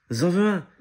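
put-on high, loud little-boy cry; Maël crying out. A narrator's reading. Small boy wanting one of the swans, crying out. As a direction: burst out eagerly, pitched well up. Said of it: "s'écrie Maël".

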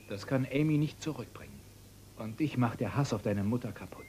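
normal talking voice

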